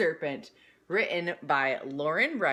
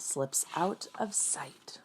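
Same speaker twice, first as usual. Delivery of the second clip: whispered narration; 6 dB quieter, slower than usual. A narrator's reading.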